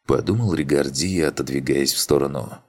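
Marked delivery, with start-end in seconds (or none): none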